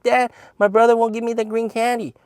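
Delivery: put-on high, childish tattling voice; whiny little brother. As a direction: mimic a little kid telling on his brother. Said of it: "He'd be like".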